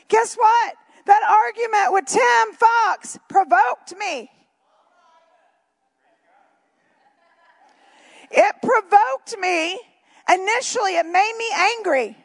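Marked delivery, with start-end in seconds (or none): 0.1-9.8 emphatic preaching. Real, not acted. Preacher telling the church about his argument, emphatic. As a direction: drive the point home from the pulpit.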